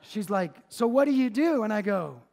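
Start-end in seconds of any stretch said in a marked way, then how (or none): 0.7-1.6 high, loud nosy demand; imitated louder questioning. Elderly lady questioning the pastor before the whole store, loud imitation. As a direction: imitate a lady raising her voice to be answered. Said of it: "and she's getting louder".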